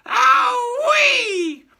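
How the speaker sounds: put-on high, loud old woman's whooping shriek; screeching old witch; gleeful screech. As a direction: shriek with wild glee. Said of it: "She screamed up".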